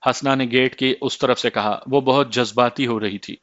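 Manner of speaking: ordinary delivery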